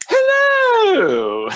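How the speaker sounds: very high, loud, long-held mock royal English; Queen's English. Showing friends another dialect, hammed-up Queen's English. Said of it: "the Queen's English".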